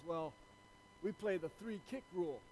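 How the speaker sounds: lightly voiced farmer, soft and understated; a hint of a quiet Texan farmer. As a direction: explain a local rule softly as the farmer. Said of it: "this Texan farmer".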